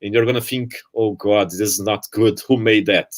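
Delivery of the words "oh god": usual speaking voice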